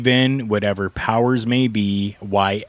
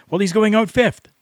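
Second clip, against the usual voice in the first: imitating a promoter in a much higher voice; nervous promoter; 12 semitones higher than usual. Promoter reads the board to the Patriot, high-voiced and nervous. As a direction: pitch it way up, as a hesitant underling reporting. Promoter answering the demanding wrestler.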